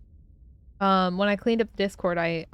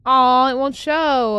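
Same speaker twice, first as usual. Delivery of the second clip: disappointed; 4 semitones higher, 8 dB louder, much slower than usual. Real, not acted. Emoji fails to display.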